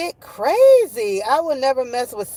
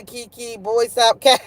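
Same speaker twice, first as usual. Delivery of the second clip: usual speaking voice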